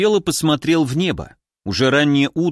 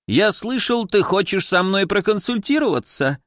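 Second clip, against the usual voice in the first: squeaky voice; 6 semitones higher than usual. A narrator's reading. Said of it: "писклявым голосом сказал он".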